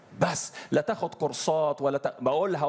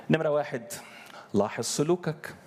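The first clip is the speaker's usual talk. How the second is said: ordinary delivery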